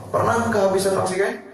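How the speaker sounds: ordinary talk, nothing marked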